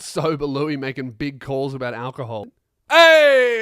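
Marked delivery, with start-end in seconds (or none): 2.9-3.6 a loud, high yelp of correction; sudden shout. Real, not acted. Catching his own slip about Ireland, a loud yelp. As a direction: blurt it out as you catch your own mistake. Corrects himself, calls it Irish.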